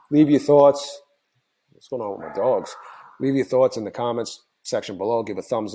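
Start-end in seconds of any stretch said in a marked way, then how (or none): 0.1-1.0 suddenly louder and higher, distracted. Real, not acted. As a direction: blurt it out over the noise. Distracted by the dogs mid-outro.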